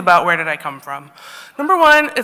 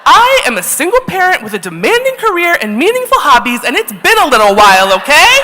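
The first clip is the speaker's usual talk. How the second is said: defensive outburst; 8 semitones higher, 7 dB louder than usual. Retold or imitated.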